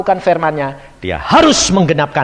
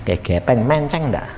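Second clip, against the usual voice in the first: usual speaking voice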